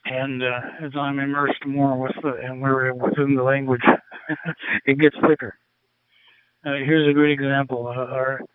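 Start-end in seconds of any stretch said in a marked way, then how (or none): none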